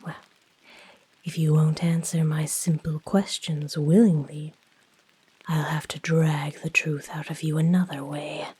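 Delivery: ordinary delivery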